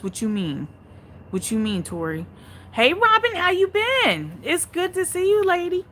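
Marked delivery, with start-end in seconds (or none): 2.7-5.8 very high, loud sing-song hello; high, loud sing-song welcome; high greeting. Real, not acted. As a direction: greet her brightly, way up high.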